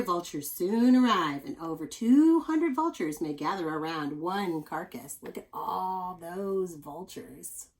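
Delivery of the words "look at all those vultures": slow amazed. Real, not acted. Marvelling at picture.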